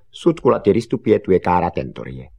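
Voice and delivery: loud start, falling away at the end; lively rote delivery; brisk recitation. Acted. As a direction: rattle it off as one learnt string. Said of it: "velmi z čerstva říkal".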